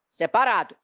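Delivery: usual speaking voice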